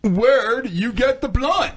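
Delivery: slow, deliberate character voice; slow role voice. Acted. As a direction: drag out the question in character. Playing Tom in skit.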